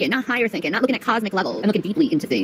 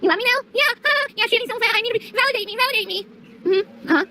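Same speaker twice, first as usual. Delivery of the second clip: much higher, giggly needy voice; mock bully voice; 10 semitones higher than usual. Mocking bullies who call Shanny fat, much higher and giggling. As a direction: parody a bully begging for approval, giggling.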